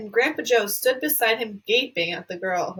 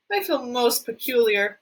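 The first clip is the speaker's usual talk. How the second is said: plain reading voice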